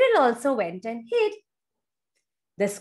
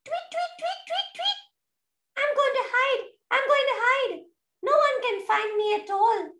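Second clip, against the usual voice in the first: much higher tiny bird voice; little birdie; 11 semitones higher, faster than usual. Acted. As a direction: chirp and boast like a little bird. Birdie flying off to hide.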